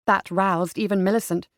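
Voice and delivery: ordinary delivery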